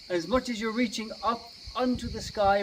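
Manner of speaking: ordinary delivery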